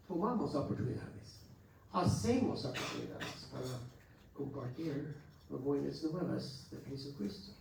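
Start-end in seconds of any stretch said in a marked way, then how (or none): none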